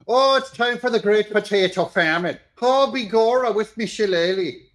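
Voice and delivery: high hammed-up stage-Irish brogue; parodying a pundit with a crude Irish brogue; mock Irish accent. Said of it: "Joe Scarborough making fun of an ethnicity, the Irish".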